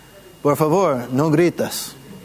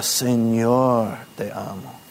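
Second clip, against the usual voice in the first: Señor leaned on, te amo falling away softer; weight on "Señor", then let "te amo" drop; stress on Señor; 4 semitones lower, 3 dB quieter, slower than usual. Shown as a demonstration. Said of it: "Enfatizando la palabra". (acento en "Señor", "te amo" más suave)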